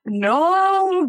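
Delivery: high, long drawn-out no; mock protest. British-born pupil hearing history class, mock dismay. Child torn over Revolutionary War class.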